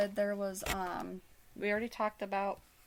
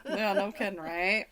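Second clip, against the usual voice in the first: usual speaking voice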